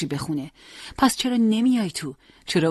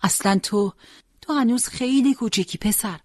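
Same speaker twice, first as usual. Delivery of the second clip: high, near-breaking mother's plea; tearful pleading mother; 3 semitones higher than usual. Acted. Mother begging son Hossein not to go to war, high pleading. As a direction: beg him to stay, voice straining higher.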